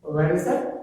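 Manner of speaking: ordinary talk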